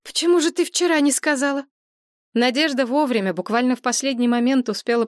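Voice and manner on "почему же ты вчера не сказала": high, upset question; agitated. Acted. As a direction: leap up in alarm. Мария вскочила с кровати. (взволнованно)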